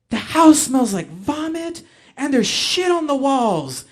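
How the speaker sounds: high, loud furious scolding; angry mom yelling. Retold or imitated. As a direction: scold him at full volume like an outraged mother. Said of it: "angry as could possibly be".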